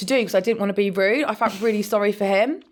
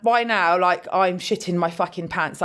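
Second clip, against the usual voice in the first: ordinary delivery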